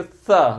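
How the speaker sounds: सा with a long hissed s at the teeth; dental सा. Shown as a demonstration. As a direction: hold the s of सा against the teeth. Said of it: "इसका उच्चारण किससे होता है? दंत". (सा को दांत से, स खींचकर बोलें)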